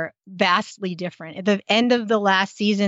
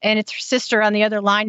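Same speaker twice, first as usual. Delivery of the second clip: ordinary delivery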